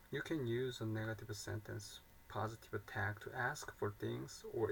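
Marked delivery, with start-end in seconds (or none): none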